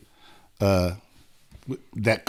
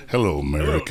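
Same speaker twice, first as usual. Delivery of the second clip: breathy vintage purr; 11 semitones lower, faster than usual. Retold or imitated. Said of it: "I loved his acceptance speech".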